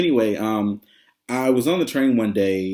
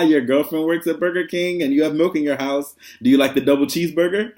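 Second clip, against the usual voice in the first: higher, louder mock kid taunt; teasing kids; 7 semitones higher, 4 dB louder than usual. Students teasing their teacher with a running joke, imitated kid voices.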